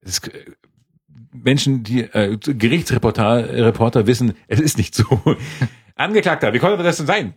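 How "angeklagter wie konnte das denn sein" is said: higher, lightly voiced stern judge. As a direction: play a questioning judge. Judge voiced in mock trial.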